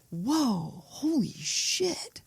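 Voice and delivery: hushed, drawn-out mock awe; soft and slow, as if floored; stunned reaction. Viewer reacting to too-real horror, stunned.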